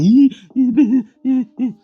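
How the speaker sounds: high, loud mock whimpering sobs; imitated sobbing. Bob locked in his room, mock sobbing high and loud. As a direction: squeal out a boy's hammed-up crying. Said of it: "viu ele chorando".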